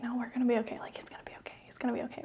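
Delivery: whispered to herself, fading; whispered self-reassurance. Retold or imitated. Wife driving to hospital, whispering reassurance to herself. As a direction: hush it like a prayer in your head. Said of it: "I started saying in my head".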